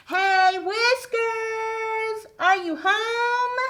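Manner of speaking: high, loud sing-song call. Acted. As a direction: call out to someone in the next room. Calling the puppet again.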